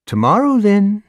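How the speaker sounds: high, coy busybody; coy neighbor. A narrator's reading. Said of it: "Missus Muller said".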